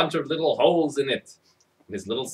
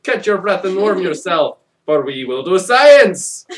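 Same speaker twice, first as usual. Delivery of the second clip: put-on old lady greeting the boy as a mad scientist; 5 semitones higher, 9 dB louder than usual. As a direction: relish the word science like a villain. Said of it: "Missus Nickerson said".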